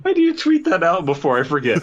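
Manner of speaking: high, breathless words through real laughter; laughing hard. Real, not acted. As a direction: barely get it out while cracking up. Cracking up at the joke.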